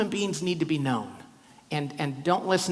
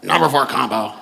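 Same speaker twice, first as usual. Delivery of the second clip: louder, curt counter call; bored counter call; 3 semitones lower, 8 dB louder than usual. Retold or imitated. Airport cashier calling his order, loud and bored. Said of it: "And they're like".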